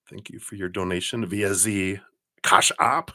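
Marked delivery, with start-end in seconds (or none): 2.4-3.1 hard, punched German-accented delivery. Real, not acted. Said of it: "I go German every time I say that".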